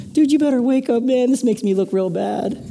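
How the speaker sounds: high-pitched comic plea; hammed-up worry about looking bad; mock urgent pleading. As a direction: beg him in a high, put-on voice. Paul pleading with dead youth, comic.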